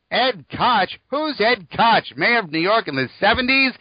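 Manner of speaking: high, slow confused fan; puzzled youngster asking; young fan voice. Retold or imitated.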